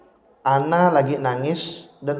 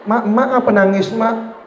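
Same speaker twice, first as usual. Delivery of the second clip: put-on child voice; 7 semitones higher than usual. Child voiced asking mother.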